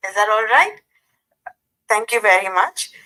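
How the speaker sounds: normal talking voice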